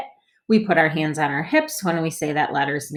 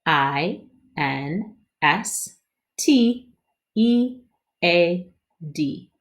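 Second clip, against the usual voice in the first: slow letter spelling; much slower than usual. Shown as a demonstration. Teacher leads body spelling for kids. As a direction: spell each letter deliberately. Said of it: "spell 'em with our body and with our voice".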